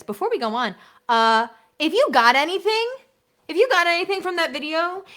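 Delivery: high, loud eager plea; excited appeal. Real, not acted. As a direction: pump them up to like it.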